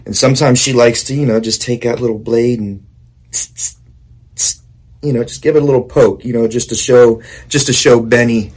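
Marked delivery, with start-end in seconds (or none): 3.3-4.6 stabbing hiss. Retold or imitated. Mimics small blade pokes.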